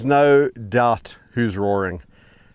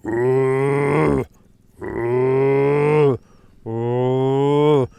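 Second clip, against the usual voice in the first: another round of mock roar hoots, a touch steadier; corrected lion roar impression; 2 semitones higher, 3 dB louder, much slower than usual. Keeper correcting his lion impression, mock roaring again. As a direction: redo the roar calls, trying to get it right. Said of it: "It's something like that".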